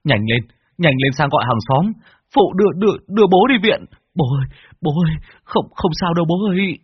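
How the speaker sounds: urgent high-pitched stammered cries; crying out. A narrator's reading. Son calling to get father to hospital, crying out. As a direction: call for help in rising panic. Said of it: "ôm chầm lấy bố mà gào lên".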